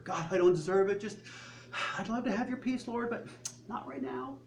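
mock martyr begging off, voice rising; pleading martyr. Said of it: "We're playing the martyr".

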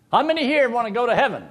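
high, loud preacher calling out to the crowd; booming preacher. Retold or imitated. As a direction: play the pulpit preacher. Preacher voiced asking congregation.

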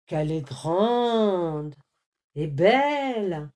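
gasping in awe at the sight; amazed exclaim. A narrator's reading. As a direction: marvel at it out loud. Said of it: "s'exclama-t-il émerveillé".

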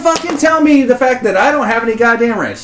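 loud, high hammed-up tirade; over-the-top fake outrage; mock rant. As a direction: rant like a man who's been wronged. Mock rant after ranch joke.

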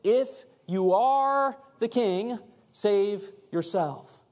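"If you are the king, save yourself" stretched slow, "If you are" pitched high; slow emphatic challenge. Acted. Repeating the mockers' words to Jesus, "If you are the king, save yourself" slow and emphatic. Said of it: "just so powerful where he says".

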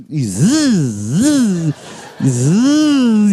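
slow, droning buzz imitation; buzzing noise. Comedian imitating the vibrator's buzzing sound. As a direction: drone a long mechanical buzz.

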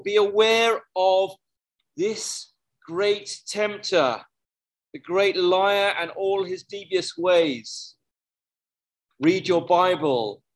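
slow, insistent sermon warning; preaching at full force. Real, not acted. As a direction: drive the warning home, slowly. Preaches warning against the tempter.

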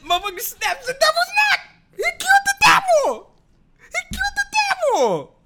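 high, loud disbelieving shout; excited. Real, not acted. Vlogger to viewers about the ending, excited shout. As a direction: yell it out in delight.

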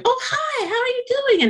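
high, bright imitated hello of a friend on the phone; cheery phone greeting. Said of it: "people just going".

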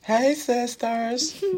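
very high, slow drawn-out greeting; James Charles voice. Retold or imitated. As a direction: sing out the greeting like a beauty guru.

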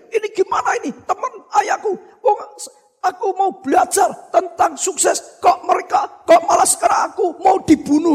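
lightly acted bewildered panic; panicked thinking. Panicked boy's thoughts while drowning.

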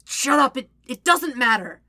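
pressing, slightly desperate dismissal; a little desperate. A narrator's reading. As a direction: stumble over it, urgent and flustered. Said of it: "a little desperately".

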